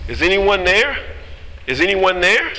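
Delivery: 提高音调大声呼喊; calling out. Acted. Hiker story, rescuer calling out.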